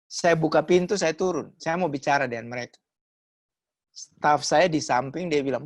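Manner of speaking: ordinary talk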